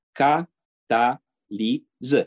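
Cataliză drawn out syllable by syllable, very slow; overly slow explanation. Retold or imitated. Engineer telling the Chinese guest the word, exaggeratedly slow. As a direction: spell Cataliză out as if to a child. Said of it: "Spune-i chinezului".

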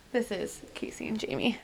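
hushed whisper. Real, not acted. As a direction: breathe it out as a secret. Whispered podcast intro aside.

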